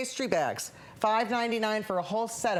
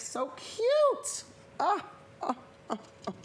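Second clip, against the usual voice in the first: cute squeal; 10 semitones higher, slower than usual. Real, not acted. Delighted squeal over pastries.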